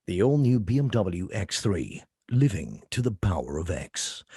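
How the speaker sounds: low, slow car-ad voiceover; dramatic, confrontational. Shown as a demonstration. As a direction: give that mean car weight, deep and deliberate. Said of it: "it's dramatic. It's, it's confrontational".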